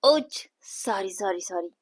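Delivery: slow, very soft apology; low voice. Acted. Priya apologising to her sister, in a low voice. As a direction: murmur a drawn-out sorry. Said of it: "हळू आवाजात कान पकडून म्हणते".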